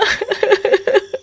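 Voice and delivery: witch cackle. Acted. Witch girl laughs at night.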